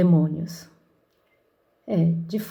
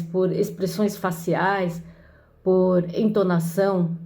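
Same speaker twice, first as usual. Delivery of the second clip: usual speaking voice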